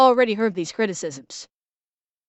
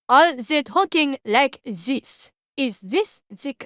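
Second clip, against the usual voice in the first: slow, deliberately bad mock-Japanese accent; 3 semitones higher than usual. Shown as a demonstration. Asking Briar about the game's voice acting, deliberately bad Japanese accent. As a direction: put on a terrible fake Japanese accent. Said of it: "I'm doing a deliberately bad accent".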